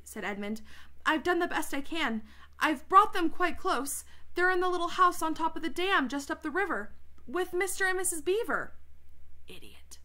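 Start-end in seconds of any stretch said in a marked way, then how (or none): 1.0-8.7 put-on high-pitched, anxious boy excuse; pleading Edmund. A narrator's reading. 9.5-10.0 low, soft insult under the breath; muttered aside. Real, not acted. Reader's aside about Edmund.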